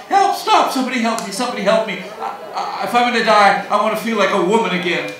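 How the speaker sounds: high, loud mock panic; hammed-up shrieking for help; screaming woman. Woman on a plummeting plane, acted screaming. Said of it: "a woman back in the plane starts screaming".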